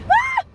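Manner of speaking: piercing high shriek; terrified scream. Real, not acted. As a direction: scream in sheer fright. Spider panic.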